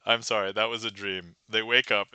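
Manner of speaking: ordinary delivery, nothing marked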